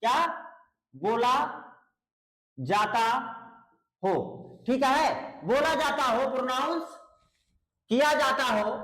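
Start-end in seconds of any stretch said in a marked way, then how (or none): 0.0-4.1 halting, one word at a time; word-by-word dictation. Shown as a demonstration.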